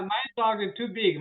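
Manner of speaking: usual speaking voice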